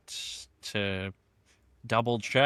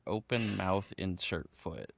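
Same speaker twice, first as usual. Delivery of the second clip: softer, laughing through the slogan; 5 semitones lower, 8 dB quieter than usual. Real, not acted. Co-host imagining the slogan on a shirt, laughing softly.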